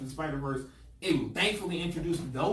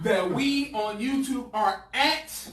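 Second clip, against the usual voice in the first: loud, slow, building up the big reveal; hype announcement; 8 semitones higher, 7 dB louder than usual. Pretend. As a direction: announce it like a huge deal. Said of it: "let me just give the official announcement".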